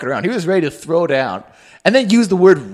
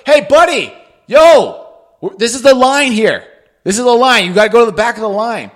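loud, high challenge to a line-cutter; confrontational shout; 6 semitones higher, 8 dB louder than usual. Acted. Man in line yelling at a line-cutter, confrontational shout. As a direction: call him out, loud and high.

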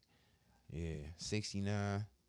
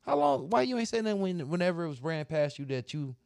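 higher, louder, fast angry ranting; heated rant; 10 semitones higher, 5 dB louder than usual. Retold or imitated. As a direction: vent the anger, rising to a yell. Angry retelling of argument.

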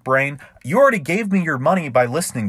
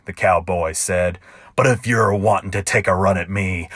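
ballad narration; 4 semitones lower than usual. A narrator's reading. Narrator reading his cowboy ballad to listeners, rhythmic and sly. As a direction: spin it like a campfire tall tale.

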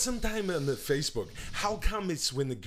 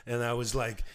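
normal talking voice

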